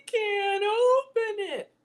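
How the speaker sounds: put-on high, slow whiny child; mock child's whine; kids whining. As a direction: drag it out like a helpless kid. Kids whining about crab.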